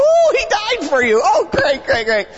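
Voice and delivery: high, sarcastic gushing; sarcastic high-pitched delight; mock excitement. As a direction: gush over it with fake excitement. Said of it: "And I get all excited".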